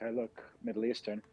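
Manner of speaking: ordinary talk, nothing marked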